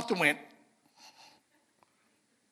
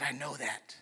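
low, soft near-whisper of the Satanist; lightly voiced sinister craving; hushed menace; 6 semitones lower, 12 dB quieter than usual. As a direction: breathe the words, savouring them. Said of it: "And she said".

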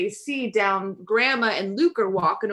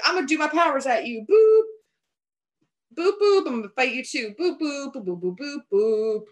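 goofy pretend magic zaps; mock zap noises; 4 semitones higher than usual. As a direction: play at casting spells. Mom zapping powers, mock.

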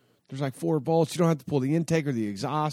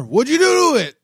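much higher and louder accusing question; voicing Billy; 13 semitones higher, 9 dB louder than usual. Billy the builder asking what went wrong, voiced much higher and louder. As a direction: toss it back like the builder's mock suspicion.

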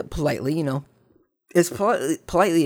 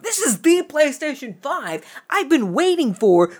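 elated squeal; 12 semitones higher, 4 dB louder than usual. Retold or imitated. Finding his missing console in the package room, high giddy squeal.

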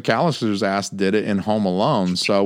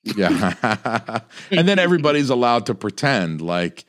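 usual speaking voice

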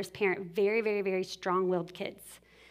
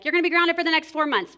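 fast, louder, higher empty threat; mock threat; 7 semitones higher, 7 dB louder, faster than usual. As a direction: blurt out a fake punishment. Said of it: "typically it'll be like".

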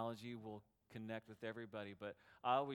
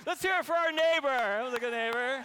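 high, loud call to the crowd; big, high emcee-style cheer; rousing cheer; 12 semitones higher, 18 dB louder than usual. Real, not acted. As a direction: whip up applause for the neighbor.

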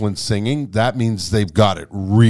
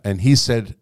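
usual speaking voice

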